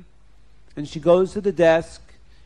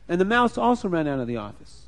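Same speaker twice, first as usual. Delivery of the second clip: ordinary delivery, nothing marked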